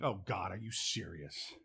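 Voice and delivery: hushed, low, imitated inward prayer; dismayed aside. Preacher retelling silent plea to God, dismayed aside. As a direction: drop to a soft, slow disbelieving aside to God.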